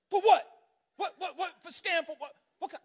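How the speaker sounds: high, loud demanding "for what"; aggressive. Retold or imitated. Father confronting TSA agent, aggressive demand. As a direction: push back hard, demanding. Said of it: "now I get real aggressive".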